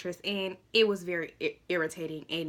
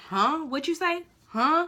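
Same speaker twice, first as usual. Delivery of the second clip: raised, higher "huh?" as if over bar noise; mock straining to hear; 8 semitones higher, 4 dB louder, slower than usual. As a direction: act like you can't hear him in the noise.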